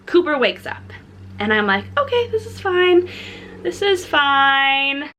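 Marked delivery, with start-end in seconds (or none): 2.0-3.1 high-pitched, strained self-reassurance; forced calm. Retold or imitated. New mom telling herself as baby wakes, forced calm. 3.6-5.1 slow, drawn-out self-reassurance; forced calm. Retold or imitated. New mom reassuring herself in the store, slow forced calm.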